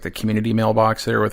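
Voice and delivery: ordinary talk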